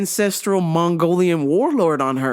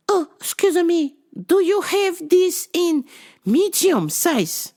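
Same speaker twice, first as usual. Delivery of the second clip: high, slow imitation of a Chinese-accented shopper; mock Chinese accent; 9 semitones higher, slower than usual. Shopper mistaking customer for store staff, imitated high Chinese accent. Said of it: "all the Chinese ladies think I work there".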